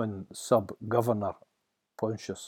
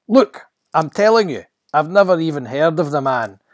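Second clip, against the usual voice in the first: loud, raised-pitch protest; vehement denial; 6 semitones higher, 13 dB louder than usual. Acted. Peter denying his master once more, vehement. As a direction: insist angrily that they're wrong.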